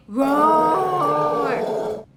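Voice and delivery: drawn-out mock roar; lion roar. Teacher asking the child to copy the lion, long playful roar. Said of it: "A lion makes a roaring noise".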